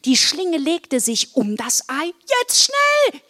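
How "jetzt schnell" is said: very high, loud cry; urgent call. A narrator's reading. Wilma urges Marla as the rope catches, urgent high shout. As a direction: shout it high, urging a friend on. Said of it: "rief Wilma".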